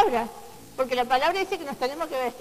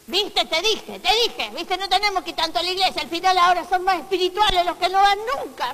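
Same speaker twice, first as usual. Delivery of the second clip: loud, fast smug gossip; smug churchgoer; 3 semitones higher, 7 dB louder than usual. Retold or imitated. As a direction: gloat loudly, fast. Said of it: "church-avoider voiced mockingly". (persona engreída, rápida y fuerte)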